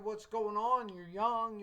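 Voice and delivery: surprised reassurance. Retold or imitated. Teacher reacting to a young student who says she feels terrible, surprised. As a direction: shake her out of it kindly.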